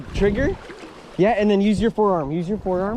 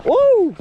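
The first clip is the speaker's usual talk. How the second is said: excited whoop; 9 dB louder than usual. Real, not acted. Cheering whoop.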